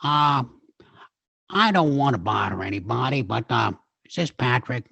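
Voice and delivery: lightly voiced Peter Falk as Columbo, hesitant and apologetic; a hint of Columbo, halting and polite; Columbo impression. Impressionist doing Columbo for the host, mild halting apology. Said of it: "if he was here right now, he'd say".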